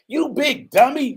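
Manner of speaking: high, loud, drawn-out teasing; mocking roommates. Imitating college roommates ribbing him, high mocking jeer.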